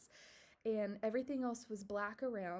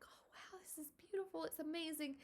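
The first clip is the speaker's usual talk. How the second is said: awed, tearful whisper, rising high; teary whisper; 7 semitones higher, 3 dB quieter than usual. Retold or imitated. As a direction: whisper it as if a happy tear is falling. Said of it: "get a tear in my eye".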